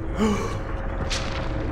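long, voiceless gasp; sharp intake in shock. Real, not acted. Player seeing what is happening, gasping.

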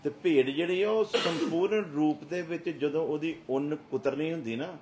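loud, high preaching at full force; fiery preaching. Acted. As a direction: thunder it at the congregation. Preaching about the sheep.

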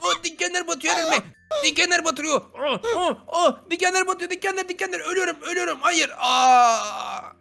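high-pitched mock dying wails; panicked pain cries. Player dying on thorns in the game, pained panic. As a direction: wail in play pain, weakening then crying out.

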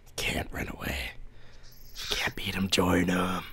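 eerie whisper. Pretend. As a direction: breathe it like a spooky secret. Spooky whisper about Minecraft.

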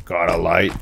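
low monster-voice question; deep repeated line. Acted. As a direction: growl the same line low, again and again. Repeated in-game creepy line.

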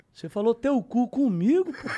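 mock-offended loud complaint; retold indignation.

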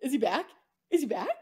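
climbing, incredulous gasps of delight; stunned excitement. Retold or imitated. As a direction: reenact the shock of seeing him back on the podium. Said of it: "us being like".

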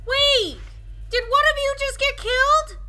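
loud, high frantic questions; mother realizing a cub is gone, panicked yelling; panicked shouting. Real, not acted. Realizes a baby was killed.

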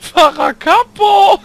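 high, loud, slow drawn-out yell; goofy call-out. Retold or imitated. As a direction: shout the name like the dopey buddy. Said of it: "Weil immer mit dem Lispeln dabei".

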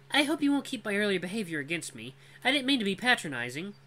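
high, apologetic female voice; apologetic young woman. Acted. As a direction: apologize sincerely. Cora apologizes to narrator.

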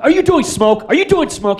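high, loud impatient yell. Retold or imitated. Actor yelling at the smoke crew on set, loud and high. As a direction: bark out the complaint mid-take. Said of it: "He's, like, yelling".

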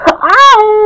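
long, high cry of distress; acted high-pitched bawl; crying wail. A narrator's reading. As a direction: let out a loud sustained wail. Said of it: "as he started crying".

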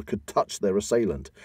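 ordinary delivery, nothing marked

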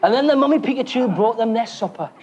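ordinary talk

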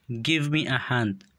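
each word said slowly and distinctly for learners; slow and clear. Shown as a demonstration. Teacher models English phrase for learners, slow and clear. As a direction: model the phrase slowly so students can copy it. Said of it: "به خاطر آموختن تلفظ درستشان".

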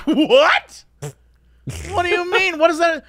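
high, incredulous outburst; mock bewilderment. People in line baffled by the drunk's story, incredulous. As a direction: react as if utterly baffled, half laughing. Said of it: "we're like".

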